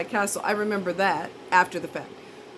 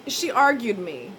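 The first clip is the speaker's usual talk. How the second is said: ordinary talk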